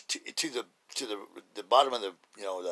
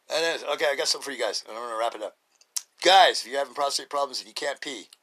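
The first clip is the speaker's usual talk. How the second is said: ordinary talk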